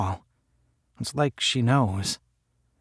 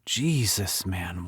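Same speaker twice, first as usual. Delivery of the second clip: ordinary delivery, nothing marked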